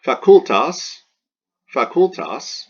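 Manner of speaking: "facultas" stressed on CUL, fa-CUL-tas; loud, high demonstration of "facultas" with weight on CUL; stress on the penult. Shown as a demonstration. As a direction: punch the middle syllable of "facultas". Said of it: "So go to the penult".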